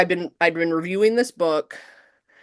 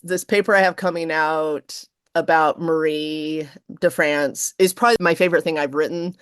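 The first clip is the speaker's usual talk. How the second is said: ordinary talk, nothing marked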